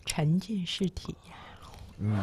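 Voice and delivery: slow, soft whisper; ASMR whisper. Pretend. Host jokingly whispers an immersive experience for listeners, slow whisper. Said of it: "你能不能给大伙儿整一个那啥呀".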